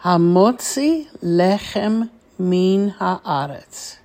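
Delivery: measured blessing with long pauses between phrases; deliberate teacher's model of a blessing; slowly, phrase by phrase. Shown as a demonstration. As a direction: recite each phrase carefully for repeating. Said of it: "I will say it slowly".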